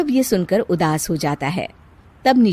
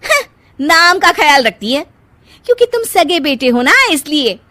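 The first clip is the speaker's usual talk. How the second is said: higher, louder, crying scoff; bitter tearful scoff; 6 semitones higher, 6 dB louder than usual. Acted. Kirti scoffing to her husband through tears.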